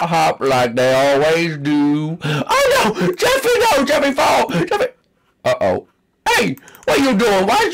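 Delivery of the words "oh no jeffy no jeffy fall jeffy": high, crying alarm; kid's panicked wail. Acted. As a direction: wail as if falling. Character falls in game.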